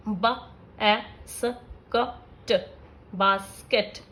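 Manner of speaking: slow letter-by-letter phonics blending; sounding out basket. Shown as a demonstration. Teacher sounding out a word for kids, slow and separated. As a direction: spell out ब, ए, स, क, ट one sound at a time, then blend into बास्केट. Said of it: "जैसे".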